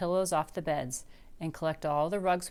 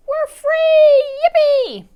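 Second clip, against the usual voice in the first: cheer; 15 dB louder, much slower than usual. A narrator's reading. Family cheers witch gone.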